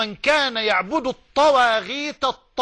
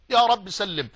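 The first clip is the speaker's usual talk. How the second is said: ordinary delivery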